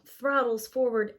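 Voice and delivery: plain reading voice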